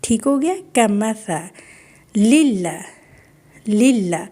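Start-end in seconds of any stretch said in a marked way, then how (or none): 2.2-4.2 للہ with both لام thin and light; thin lam. Shown as a demonstration. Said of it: "یہ لام دونوں باریک ہے". (للہ باریک لام سے)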